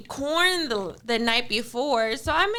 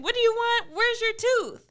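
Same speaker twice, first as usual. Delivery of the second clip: excited mom; 8 semitones higher than usual. Retold or imitated. Mom gushing over lost tooth.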